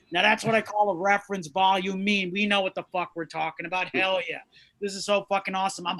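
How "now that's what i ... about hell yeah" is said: ordinary talk, nothing marked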